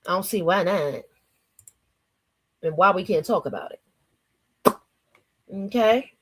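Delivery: normal talking voice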